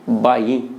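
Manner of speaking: बाई with plain b and low pitch; Haryanvi बाई. Shown as a demonstration. Teacher showing the Haryana form of भाई, said low. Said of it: "वहाँ उच्चारण क्या है?".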